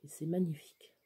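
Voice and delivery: an unvoiced little whisper; whispered, almost to herself. Real, not acted. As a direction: breathe it out as a secret. Aside at the camera.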